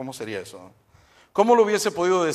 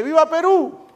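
a lone much higher, louder "¡Viva Perú!" cheer; shout; 14 semitones higher, 8 dB louder than usual. Retold or imitated. Lone Peruvian fan among Colombians, shouting much higher and louder. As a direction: yell it out above the crowd. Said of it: "se oye uno que dice".

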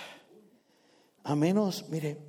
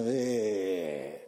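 letdown grunt; 9 semitones lower, 5 dB quieter than usual. Retold or imitated.

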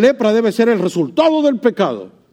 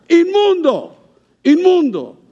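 high calling shout of unclean; leper's cry; 8 semitones higher, slower than usual. Retold or imitated. Preacher voicing a leper warning the crowd, high cry. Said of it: "tenía que gritar".

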